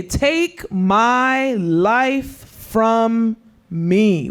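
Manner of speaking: dragged-out plea. Retold or imitated. Preacher voicing Jonah to the youth, slow pleading. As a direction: take my life from me, stretched very slowly.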